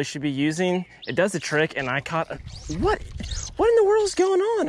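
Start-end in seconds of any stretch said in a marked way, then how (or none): 2.8-4.7 surprised. Real, not acted. Angler interrupted by yet another fish, high astonished squeal. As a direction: squeal in disbelief at the bite.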